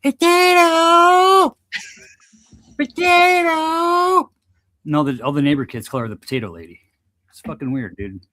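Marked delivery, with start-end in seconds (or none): 0.0-1.6 put-on high, drawn-out old lady shout; potato lady yell. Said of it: "she'll stand on her porch and she'll go". 2.8-4.3 put-on high, very slow old lady holler; potato lady yell. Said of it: "she'll stand on her porch and she'll go".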